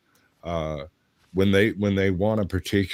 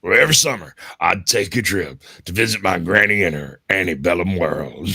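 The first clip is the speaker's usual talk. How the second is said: Southern storyteller voice; 7 dB louder than usual. Pretend. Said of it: "When I did the Mississippi Squirrel Revival".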